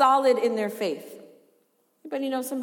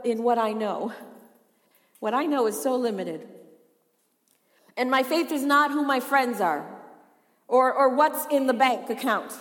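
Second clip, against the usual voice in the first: normal talking voice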